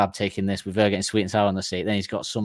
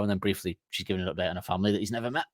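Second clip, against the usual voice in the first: usual speaking voice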